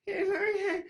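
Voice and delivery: high, laughing, disbelieving yeah okay; very high laughing mock auntie. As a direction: giggle it out like a doubtful aunt. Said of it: "Auntie's like".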